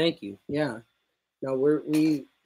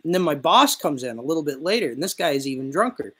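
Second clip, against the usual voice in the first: usual speaking voice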